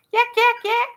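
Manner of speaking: high, loud mocking chatter; mock yakking. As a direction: squawk it like a chatterbox. Mocking her own yakking.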